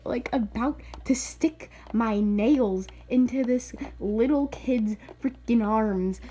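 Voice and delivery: ordinary talk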